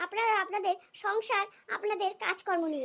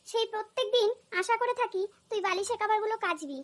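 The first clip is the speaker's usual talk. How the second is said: ordinary talk, nothing marked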